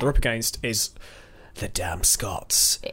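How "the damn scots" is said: low, drawn-out mock-ominous reveal; dramatic reveal. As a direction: stretch it out slowly and drop the pitch.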